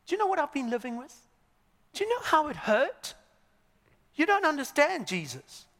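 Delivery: high-pitched, rapid-fire indignant questions; wounded, worked-up objector; incredulous protest. Acted. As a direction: protest in hurt disbelief, pitch climbing. Protesting objector to Jesus, acted.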